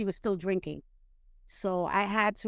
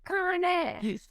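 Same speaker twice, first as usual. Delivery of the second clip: boss's call; 10 semitones higher than usual. Retold or imitated. Boss's exclaimed call, retold.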